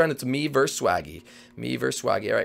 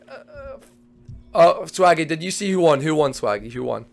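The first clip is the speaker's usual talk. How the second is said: usual speaking voice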